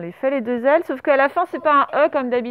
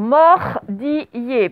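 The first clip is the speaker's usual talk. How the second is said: MORDILLER stretched slowly over separate syllables; syllable-by-syllable dictation; much slower than usual. Shown as a demonstration. Teacher dictating the fourth word to pupils, syllable by syllable.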